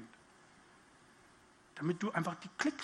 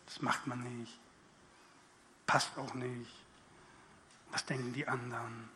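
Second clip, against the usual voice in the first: low, soft, disapproving murmur; voice of reason; 7 semitones lower, 6 dB quieter than usual. Pretend. The voice of reason talking you out of it, low and grumbling. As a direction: grumble like a cautious conscience. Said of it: "Dann kommt die Stimme der Vernunft".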